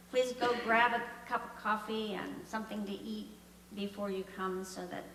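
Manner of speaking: ordinary talk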